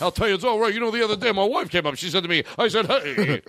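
high, punchy put-on stand-up comic; Rodney. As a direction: gripe about the family like an old-school joke teller. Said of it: "It's the guy who's Rodney".

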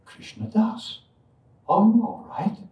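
a hint of an old devotee asking gently; old lady's concern. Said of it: "she said".

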